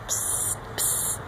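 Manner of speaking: soft unvoiced psst sounds; breathy frog call whisper. Shown as a demonstration. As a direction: whisper the frog's tiny call. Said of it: "that's what it sounds like".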